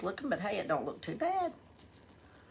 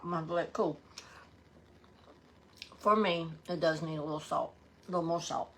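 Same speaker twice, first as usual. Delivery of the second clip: usual speaking voice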